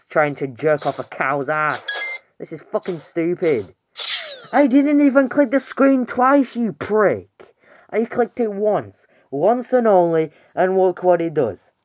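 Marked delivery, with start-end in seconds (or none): 4.5-11.7 high indignant ranting; swearing tirade. Real, not acted. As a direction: rant at the game, indignant. Raging at the game controls.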